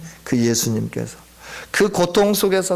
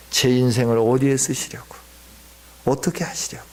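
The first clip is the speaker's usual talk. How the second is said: whispered lament; 6 semitones lower than usual. Retold or imitated. Young man kneeling in church lamenting to God, whispered. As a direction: pour out the hurt in a whisper. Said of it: "하소연을 했죠".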